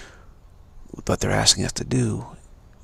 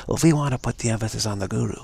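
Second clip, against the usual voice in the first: normal talking voice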